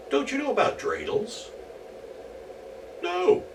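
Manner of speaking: high, surprised question; high character voices. Acted. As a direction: feign surprise at his ignorance. Dreidel dialogue.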